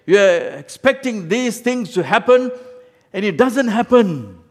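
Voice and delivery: loud, high, driving sermon voice; fiery preaching. Acted. Pastor preaching to the church, loud and fervent. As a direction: preach it hard to the congregation.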